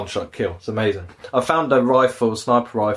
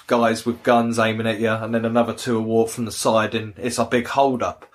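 usual speaking voice

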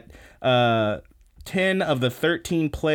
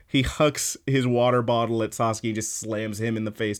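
ordinary talk

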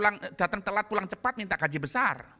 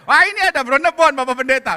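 eager offer; 6 semitones higher, 15 dB louder than usual. Retold or imitated. Pastor thanks, woman offers.

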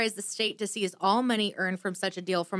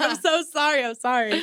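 high, louder pleading sorry; backpedaling apology; 7 semitones higher, 5 dB louder than usual. Retold or imitated. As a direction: backpedal real hard. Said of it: "backpedal real hard".

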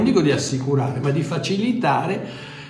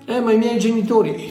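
mock objector; 9 semitones higher than usual. Imagined listener objects about parents.

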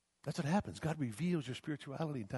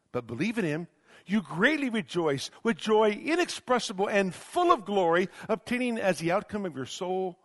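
rising, louder preaching of the verse; impassioned scripture reading; 5 semitones higher, 6 dB louder than usual. Acted. Preacher reading Peter's letter to the congregation, impassioned. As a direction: proclaim the scripture with full force.

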